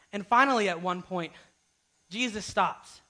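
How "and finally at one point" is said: ordinary delivery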